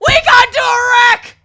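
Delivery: very loud, high-pitched blurt; imitating a guardian's sudden loud outburst; blurted shout. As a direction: yell out the news over everyone.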